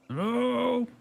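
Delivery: high, drawn-out creepy hello; creepy person voice. Pretend. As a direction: call out like a creepy stranger. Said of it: "Creepy person voice".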